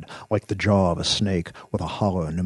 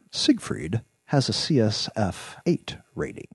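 plain reading voice

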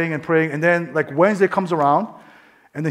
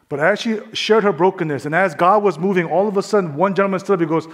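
ordinary talk, nothing marked

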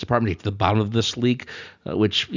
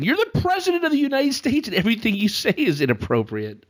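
exasperated; 12 semitones higher than usual. Real, not acted. Exasperated retort.

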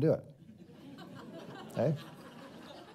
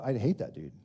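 low, soft grumble about the other guy; grumbling guy; 6 semitones lower, 5 dB quieter than usual. Retold or imitated. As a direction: mutter it like a sulking man. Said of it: "Sometimes you go".

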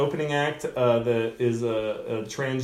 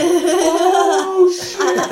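very high, loud imitation of a stunned crowd; mock crowd shock; 11 dB louder than usual. Crowd reacts to the owner's kid insulted, high loud mock shock. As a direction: mimic onlookers hooting in shock.

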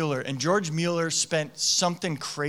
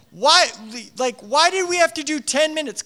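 exasperated dad; 8 semitones higher than usual. Retold or imitated. Dad retelling exasperation at tantrum.

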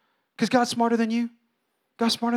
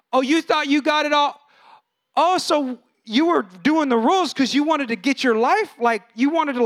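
mock; 7 semitones higher, 4 dB louder than usual. Mocking God's reply to the brother.

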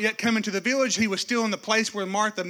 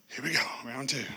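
soft, low weary aside; a tired under-the-breath sigh of round two; Jesus muttering aside; 8 semitones lower, 7 dB quieter, faster than usual. Acted. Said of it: "Jesus is in there going".

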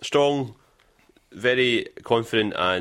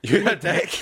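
mock Mario; 7 semitones higher, slower than usual. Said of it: "You know what Mario said".

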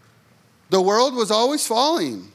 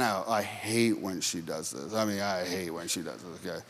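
grumbling aside; 14 semitones lower, 11 dB quieter than usual. Real, not acted. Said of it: "aside about wife".

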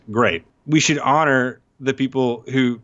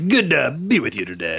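hammed-up radio host, a bit higher; morning radio host; 5 semitones higher than usual. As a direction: play the cheesy morning DJ. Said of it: "like a morning radio host".

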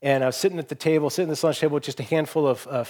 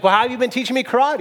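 high, quick, exasperated question; incredulous pupil; 8 semitones higher, faster than usual. Acted. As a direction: challenge the master in disbelief. Frustrated pupil challenges his master.

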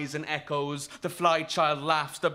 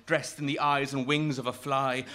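usual speaking voice

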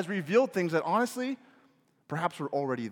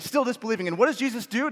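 normal talking voice

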